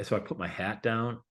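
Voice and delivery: usual speaking voice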